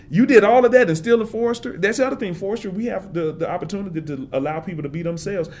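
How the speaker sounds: ordinary delivery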